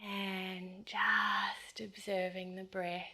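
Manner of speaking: soft, fading meditation voice; guided meditation. Acted. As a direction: ease them down into stillness. Guides back to natural breath.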